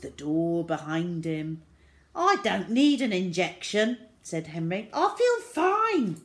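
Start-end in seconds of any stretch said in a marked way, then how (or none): 2.1-4.0 put-on a raised, higher boy's objection; lightly voiced protesting child; childish protest. A narrator's reading. Boy protests against getting injection. 4.9-6.2 put-on high, loud boy's whine of denial; childish protest. A narrator's reading. Boy protests he is well.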